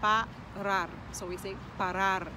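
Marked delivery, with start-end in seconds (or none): 0.0-0.9 "parar" in two beats, loud on "rar"; last-syllable stress. Shown as a demonstration. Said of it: "emphasis is on the last syllable". 1.8-2.3 "parar" with a louder final "rar"; stress at end. Shown as a demonstration. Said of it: "as the emphasis at the end".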